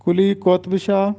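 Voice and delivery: قطب in کلی قطب Shah said qotb, ط without a vowel; درست تلفظ. Shown as a demonstration. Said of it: "اور توئے پر جزم ہوگا". (کلی قطب شاہ میں قُطْب، ط ساکن)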